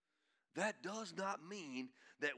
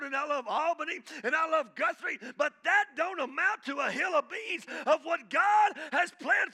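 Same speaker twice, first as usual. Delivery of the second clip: loud, high, shouting preacher; fiery revival shout; full-force preaching; 8 semitones higher, 11 dB louder than usual. Acted. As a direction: preach it out to the whole church at full volume.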